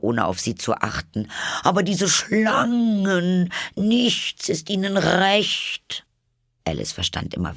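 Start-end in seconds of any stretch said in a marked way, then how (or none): 1.6-6.0 very high bird voice, complaining; a fussy bird listing its troubles; aggrieved pigeon. A narrator's reading. Pigeon voice, held figure.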